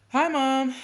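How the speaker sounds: loud, high hello; calling out a greeting. A narrator's reading. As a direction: call out to Mom across the park. Boy greets his mother.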